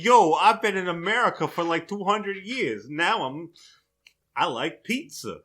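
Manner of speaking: a ghost pirate gone full New York; hammed-up New York accent; New Yorker accent. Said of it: "I don't know why I'm talking like a New Yorker".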